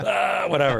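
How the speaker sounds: high, loud, careless brush-off; dismissive shrug. Retold or imitated. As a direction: toss it off like it's no big deal. Buddy in back seat shrugs off.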